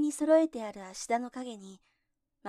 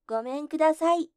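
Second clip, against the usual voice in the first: loud, high third shout; shouting again; 5 semitones higher, 6 dB louder than usual. A narrator's reading. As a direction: bellow it once more. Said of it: "と怒鳴った時".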